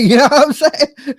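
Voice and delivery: laughing through the words; cracking up mid-line; laughing out loud. Real, not acted. Laughing at own joke.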